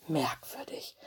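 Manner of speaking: unvoiced whisper to himself; whispered thought. A narrator's reading. Wolf cub whispering his suspicion in the dark hall. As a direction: breathe the word like a secret. Mino sniffs in eerie hall.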